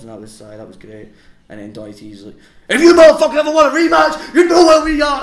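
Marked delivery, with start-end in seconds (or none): 2.7-5.2 very high, loud wrestling-promo yell; shouted challenge. Retold or imitated. Tag partner challenging the opponent on camera, yelled high and loud. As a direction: bellow the challenge at full pitch, imitating a hyped-up wrestler.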